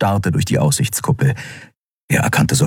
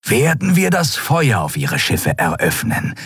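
intoned threat, slightly raised; eerie cultist broadcast; ghostly litany; 6 semitones higher than usual. A narrator's reading. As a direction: chant it as a repeated ultimatum. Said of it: "wie eine Litanei wiederholten".

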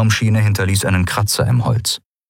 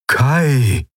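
higher, drawn-out ghostly call; helpless call; 6 semitones higher than usual. A narrator's reading. Spirit sending one last time, helpless. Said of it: "hilfloses Mal".

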